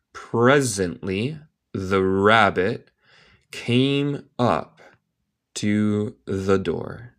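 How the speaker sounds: slow, phrase by phrase with pauses; slow careful reading. Shown as a demonstration. English teacher repeating a story line to learners, slowly.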